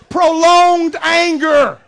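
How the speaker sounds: slow, laughing emphasis on prolonged. Real, not acted. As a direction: laugh through it, then stretch it out. Laughing, then slow emphatic term.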